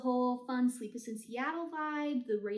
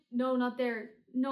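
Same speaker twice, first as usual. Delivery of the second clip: usual speaking voice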